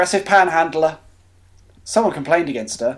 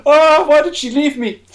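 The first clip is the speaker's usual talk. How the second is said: mock lament; 10 semitones higher, 6 dB louder than usual.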